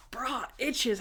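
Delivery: hushed whispered aside. Retold or imitated. Randy in disguise whispering to the team. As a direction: whisper it under your breath.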